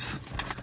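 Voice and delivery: real, soft heavy sigh; distressed sigh. Real, not acted. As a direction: let out a quiet breath, upset by the memory. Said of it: "totally freaks me out".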